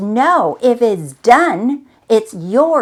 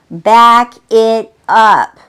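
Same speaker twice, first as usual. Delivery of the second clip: each word drawn out slow and louder; slow, emphatic repeat; 8 dB louder, much slower than usual. Shown as a demonstration. Coach urging listeners to pause, slow and firm.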